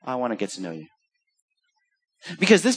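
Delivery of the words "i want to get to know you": much softer, lower, intimate; hushed tender whisper-like close. Acted. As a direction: say it like a quiet promise. Tender close of Jesus' words.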